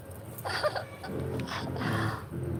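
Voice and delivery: soft, voiceless mock panting laugh; mimicked camera posing. Streamer shows how Daisy acted for the camera, soft mocking breaths. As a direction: imitate someone huffing softly for the camera. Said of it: "going like this".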